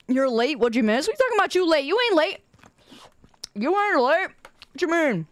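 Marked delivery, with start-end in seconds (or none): 1.1-5.3 high-pitched comic disbelief; put-on high incredulity; mock-incredulous challenge. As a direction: challenge them playfully, pitch jumping up. Mock challenge to late viewer.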